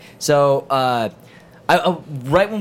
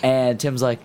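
ordinary delivery

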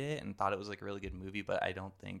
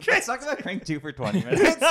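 laughing; 11 semitones higher, 7 dB louder than usual. Real, not acted. Laughing mock.

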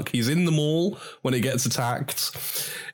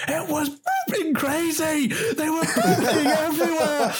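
much higher, frantic interviewee voice with a laugh; much higher hammed-up frantic Santa; frazzled Santa. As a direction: rant it like a shaken Santa on the news. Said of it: "and he's like".

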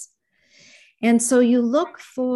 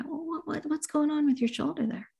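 soft, higher-pitched gentle question; gentle inquiry; 6 semitones higher, 6 dB quieter than usual. Acted. Therapist asking a client about the shoulder, very gently. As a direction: ask softly and lightly, with care. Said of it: "very gently just saying".

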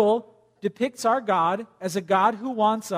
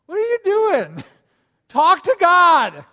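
high, loud, laughing disbelief; incredulous; 9 semitones higher, 8 dB louder, slower than usual. Acted. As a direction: exclaim in amused disbelief. Mock exasperation at fake prayer.

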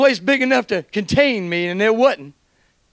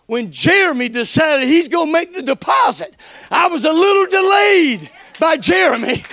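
loud, fervent preaching with shouts of praise; preaching at full force; 4 semitones higher, 4 dB louder than usual. Acted. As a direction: preach it, lifting the church.

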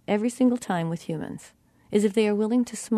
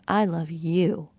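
slow, final "you" drawn out; stress on YOU; 2 semitones lower, slower than usual. Shown as a demonstration. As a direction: save the weight for "you". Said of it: "you can feel the difference".

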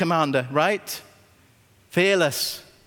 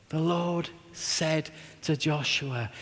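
hushed, slow; 7 dB quieter, slower than usual. Real, not acted. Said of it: "repeats verse softly".

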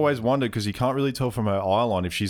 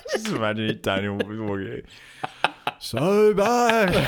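impersonating Daniel; 2 semitones higher, much slower than usual. Retold or imitated. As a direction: act half stoned and slurring. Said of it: "I love the impersonation".

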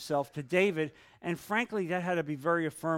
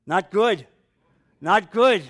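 loud dismay; 4 semitones higher, 11 dB louder, slower than usual. Real, not acted. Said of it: "shocked comment".

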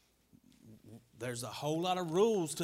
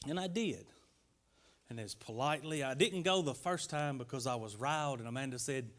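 ordinary talk

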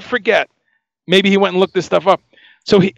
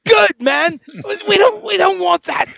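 very high mock English accent; 13 semitones higher, slower than usual. Boss gives approving thumbs up, high bad English accent. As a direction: imitate an English boss, pitched way up. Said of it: "sorry for the bad English accent".

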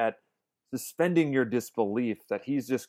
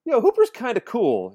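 impressed realization; 11 semitones higher, 7 dB louder than usual. Retold or imitated. Voices own newfound admiration for Hooper.

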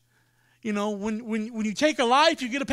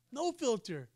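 "No filter" dropped much softer; quiet aside; 11 dB quieter than usual. Shown as a demonstration. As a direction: mutter it to yourself as an echo. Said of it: "she has no filter".

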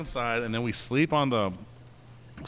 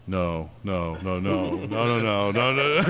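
mounting refusal; 5 semitones lower, slower than usual. Retold or imitated. Acting out a spooked friend at the door, mounting refusal. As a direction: build a string of refusals from low mumble to alarm.

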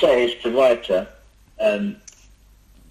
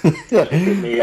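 laughing mock drama; 8 semitones higher, 4 dB louder, much slower than usual.

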